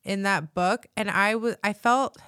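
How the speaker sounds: usual speaking voice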